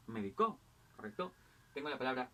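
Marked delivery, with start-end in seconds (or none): 0.1-0.6 medicó said me-di-CÓ; stress on last syllable. Shown as a demonstration. Teacher giving accent examples, medicó stressed on CÓ. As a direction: land medicó on a higher final CÓ. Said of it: "el acento puede variar".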